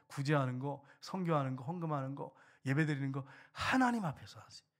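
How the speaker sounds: ordinary talk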